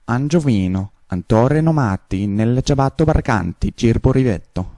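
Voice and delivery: Italian-sounding gibberish; fake Italian. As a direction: babble like Italian. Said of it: "now we do the Italian".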